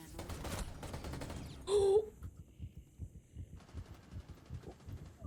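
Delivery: a long unvoiced gasp of shock; startled gasp. Real, not acted. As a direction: suck in air, caught off guard. Startled by enemy in game.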